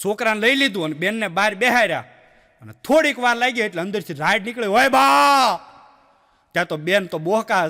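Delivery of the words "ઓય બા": hammed-up cry of pain from behind the door; mock yell from inside. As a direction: shout "ઓય બા!" loud and high in pain. A yell comes from inside the clinic. (અંદરથી દર્દભરી બૂમ)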